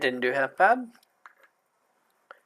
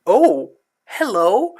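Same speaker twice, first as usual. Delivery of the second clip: slow sweet greeting; 5 semitones higher, 7 dB louder, much slower than usual. Acted. Character greets chicks.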